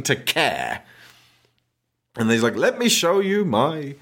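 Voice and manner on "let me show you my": [name] impression. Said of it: "I don't know why he's [name]".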